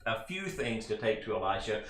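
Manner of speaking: normal talking voice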